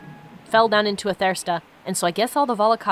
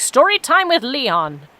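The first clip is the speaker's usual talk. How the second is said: slow, louder hammed-up show title; mock show announcer; 4 semitones higher, 6 dB louder, slower than usual. Streamer jokingly titles the episode like a show host. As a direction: announce it like a kids' program host.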